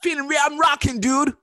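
high, loud surfer dude; stoner voice. Acted. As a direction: shout it like a hyped stoner. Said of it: "Okay, stop now".

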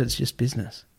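soft, resigned retelling of "It's just business"; a hint of the agent's weary apology; quietly quoting her. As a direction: drop the voice for her cold excuse. Agent's reply, quoted again.

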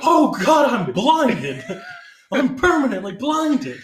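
loud, high-pitched hysterical wail; mock agonized scream. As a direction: overact a freshly blinded victim. Acts blinded victim screaming.

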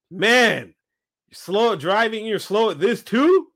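mocking the guy.

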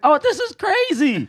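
high, slow, amazed; high slow amazed reaction in the theater. Retold or imitated. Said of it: "I was like".

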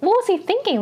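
high-pitched, incredulous rant; exasperated anger. Retold or imitated. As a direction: demand it in exasperated disbelief. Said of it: "I was very angry when I saw this".

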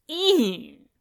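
high, emphatic "ew" of revulsion; emphatic disgust. A narrator's reading. Sister figure rejecting any idea of romance, emphatic disgust. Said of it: "Eliza agreed emphatically".

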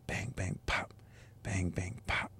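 hushed, near-whispered drum rhythm; imitated drum beat. Dad retelling toddler's pots-and-pans beats, hushed rhythmic imitation. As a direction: mimic banged-out beats very softly. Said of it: "he started making beats".

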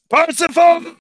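loud furious call; shouting a name. Acted. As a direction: yell his name across the room.

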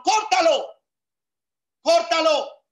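forceful command. Real, not acted. Pastor ordering the congregation to cut off toxic people, loud shout.

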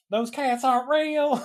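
very high put-on voice; mock complaining lady. [name] mimics lady who saw the video, high mocking voice. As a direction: imitate a clueless onlooker way up high. Mocking a lady's comment on art.

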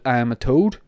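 ordinary talk

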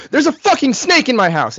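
high, urgent retold panic; acting out a frantic phone call; panicked outburst. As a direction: blurt it out in high-pitched alarm.

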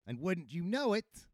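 plain reading voice

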